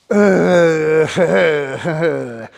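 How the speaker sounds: loud amused laugh; hearty real laugh. Real, not acted. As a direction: burst out laughing at the ugly part. Laughs at "the ugly.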